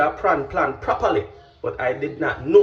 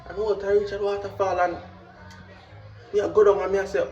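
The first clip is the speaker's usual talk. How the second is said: ordinary talk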